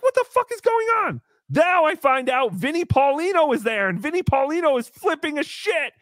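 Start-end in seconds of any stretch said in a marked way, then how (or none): 0.0-1.2 exasperated. Retold or imitated. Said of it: "So I'm like".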